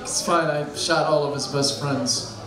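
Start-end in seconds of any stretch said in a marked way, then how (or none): none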